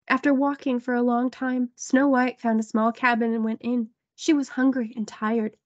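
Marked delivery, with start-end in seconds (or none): none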